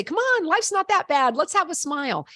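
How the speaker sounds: high, playful coaxing to smile; teasing coax. Retold or imitated. Teacher coaxing Moroccan students to smile, playful and high. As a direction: rib the students into smiling, voice lifted high. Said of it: "rib them a little bit".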